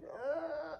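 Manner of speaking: loud, high straining groan; hammed-up grunt of a hard push; acted pushing groan. Mother pushing baby out, straining groan.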